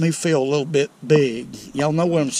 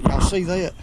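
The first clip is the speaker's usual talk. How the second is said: normal talking voice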